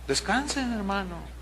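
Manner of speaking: soft, gentle exhortation; hushed and reassuring, trailing down; soothing. Acted. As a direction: ease them into rest, voice dropping away. Urges congregation to rest after sighing.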